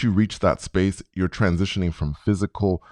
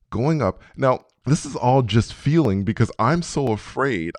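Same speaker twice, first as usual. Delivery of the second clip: ordinary talk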